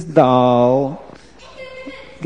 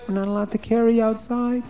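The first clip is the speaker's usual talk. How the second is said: put-on higher, softer girl's voice; protesting girl; 6 semitones higher, 7 dB quieter than usual. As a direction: plead gently with the queen.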